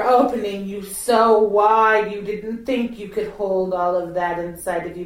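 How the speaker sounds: ordinary talk, nothing marked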